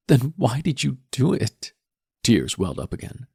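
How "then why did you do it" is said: put-on high, slow, pleading teen girl; tearful daughter. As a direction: demand why through tears. Daughter crying at father.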